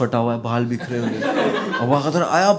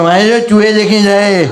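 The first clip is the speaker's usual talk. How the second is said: panicked Aba Kadar; 5 semitones higher, 8 dB louder than usual. Retold or imitated.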